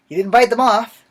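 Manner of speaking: loud, high exclamation; loud emphatic. Real, not acted. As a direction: burst out with the relief. Loud excited retelling.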